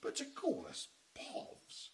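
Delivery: slow breathy scandalised posh gasp; outraged posh. Acted. Posh husband appalled at being called poor. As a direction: clutch pearls, appalled.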